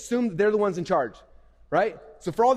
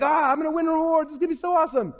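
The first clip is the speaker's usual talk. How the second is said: high-pitched burst of mock excitement; excited monkey; 9 semitones higher than usual. Emotional monkey dreaming of winning an award, excitedly. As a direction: squeal it like a monkey carried away by emotion. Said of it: "it gets all excited 'cause it's emotional".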